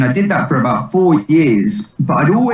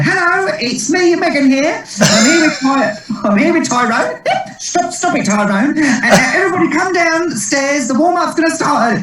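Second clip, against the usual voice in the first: very high, camp tannoy voice; company manager on tannoy. Retold or imitated. Impersonating Megan the company manager on the backstage tannoy, high and camp. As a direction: announce it like Megan over the speakers, shrill and silly. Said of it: "getting on the tannoy and going".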